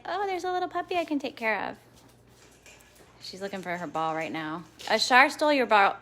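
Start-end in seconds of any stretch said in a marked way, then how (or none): 0.1-1.8 higher, lightly voiced motherly dog; voicing the dog. Owner voicing her dog greeting the puppy, high and light. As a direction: give the dog a sweet little voice. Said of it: "She'll be like".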